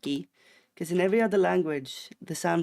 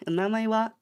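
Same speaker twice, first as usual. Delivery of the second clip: "wa" raised like a casual Japanese question; 5 semitones higher, 5 dB louder, faster than usual. Shown as a demonstration. Streamer showing a casual question without ka, questioning lift on "wa". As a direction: lift "wa" into a higher, questioning end. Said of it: "ask the question very casually".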